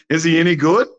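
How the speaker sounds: Trump impression. President asking the visitor's friend about him, Trump impression. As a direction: imitate the president, lifting into the question. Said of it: "Is that your Trump in, impression".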